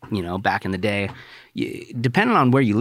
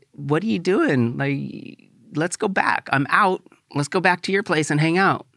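higher, urgent plea to leave; pleading retort; 7 semitones higher than usual. Retold or imitated. Asking his crush to leave the party, pleading higher. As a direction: talk her into leaving, voice pitched up.

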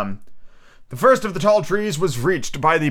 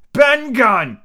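louder, higher triumphant roar; 11 semitones higher, 6 dB louder than usual. A narrator's reading. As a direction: bellow the name out. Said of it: "roared Silver".

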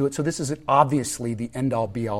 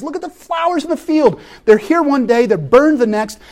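rising passion; 11 semitones higher, 6 dB louder than usual. Acted. Jesus quoted, preaching lifts.

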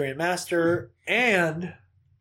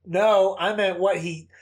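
normal talking voice

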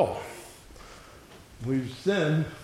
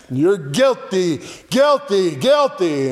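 loud, higher-pitched accusation; 5 semitones higher, 5 dB louder than usual. Acted. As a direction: hammer out the verdict loudly. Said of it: "it's this loud voice".

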